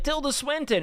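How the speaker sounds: high-pitched name, said casually; lightly acted naming. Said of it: "Like I just said".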